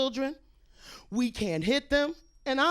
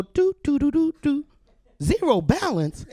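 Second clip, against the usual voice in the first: ATM beep imitation.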